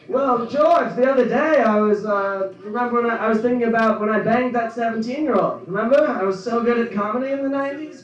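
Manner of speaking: high, loud nasal-style sitcom whine; Seinfeld. Retold or imitated. Comic doing his Seinfeld talking to George, loud impression. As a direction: do a whiny sitcom comedian. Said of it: "gonna do my Seinfeld".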